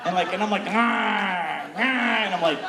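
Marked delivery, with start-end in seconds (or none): 0.7-1.8 hammed-up sexual groan; acted sex cry. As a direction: cry out in mock pleasure. Acting out sex noises.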